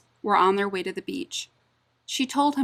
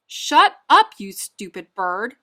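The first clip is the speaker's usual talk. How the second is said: angry shout; 8 dB louder, slower than usual. Acted. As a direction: yell at the bird in fury. Owner yells at the parrot.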